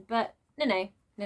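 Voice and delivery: ordinary talk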